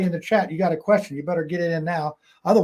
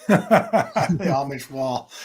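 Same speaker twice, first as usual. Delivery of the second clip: laughing; 4 semitones lower, much slower than usual. Real, not acted.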